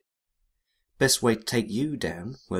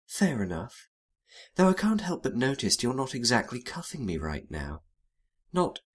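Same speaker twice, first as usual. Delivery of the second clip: softer, playful slang from a sly seductress; lightly voiced femme fatale, drifting softer; teasing femme fatale; 4 dB quieter than usual. Narrator voices femme fatale Cassandra.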